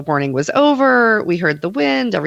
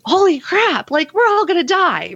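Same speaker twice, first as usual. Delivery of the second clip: high-pitched mock panic; acted freaking out; 12 semitones higher than usual. Therapist voicing her panicked part in the tornado warning, freaking out. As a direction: play the panicking inner voice, pitch jumping up. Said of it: "one part that's just freaking out".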